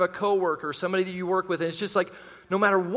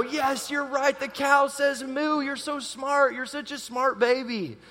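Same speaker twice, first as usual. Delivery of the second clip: put-on higher, sing-song praise for a baby; baby talk praise; 6 semitones higher, slower than usual. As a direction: gush over the clever baby. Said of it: "And we go".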